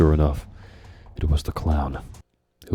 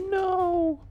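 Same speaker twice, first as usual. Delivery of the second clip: very high cry of despair; anguished cry. Acted. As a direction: cry out in loss. Boy losing mother.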